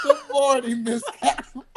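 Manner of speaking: high, giggling good morning; laughing greeting. Retold or imitated. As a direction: greet the cow through giggles. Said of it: "And I was like".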